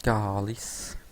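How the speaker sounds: drawn-out Québécois swear; Québécois accent. Shown as a demonstration. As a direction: curse slowly in a Québécois accent. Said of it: "je le fais un petit peu".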